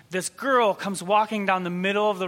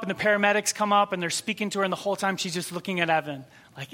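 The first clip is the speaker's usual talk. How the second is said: usual speaking voice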